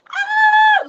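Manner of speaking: piercing high E squeal; very high violin-like cry; high dolphin squeal. Shown as a demonstration. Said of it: "like the high E on the violin".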